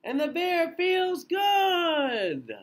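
high, loud, slow triumphant call; big cheerful storybook payoff; joyful refrain. A narrator's reading. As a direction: burst out happy for the kids.

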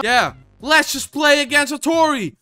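loud, high sarcastic rant; fed-up and yelling; exasperated outburst. Real, not acted. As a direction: throw it out as a heated shout.

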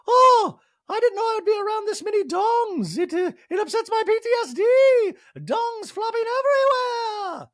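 very high, loud squealing; wailing pretend freak-out; mock panic. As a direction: shriek it in hammed-up distress. Imagined Dorit panicking at show.

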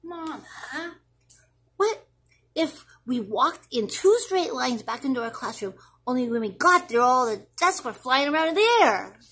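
put-on a kid bursting with a wild idea; excited child. Child voice, louder.

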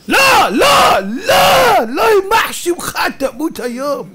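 high, loud angry shout, slow and drawn out; furious fan. Retold or imitated. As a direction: curse the player in rage. Fan cursing a player at TV.

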